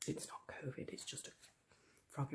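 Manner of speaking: whisper. Real, not acted. Aside about frog in throat.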